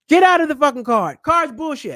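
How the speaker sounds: loud, heated rant at the card; angry outburst. Real, not acted. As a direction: blow up at the useless card. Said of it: "Excuse me. Sorry for cussing".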